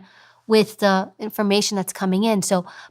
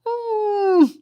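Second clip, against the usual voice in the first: long, very high closed-mouth "mm"; high-pitched mock squeal; 13 semitones higher than usual. Shown as a demonstration. Mocking the kind of guest she'd be, high-pitched hum. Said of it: "What kind of guest am I gonna be?".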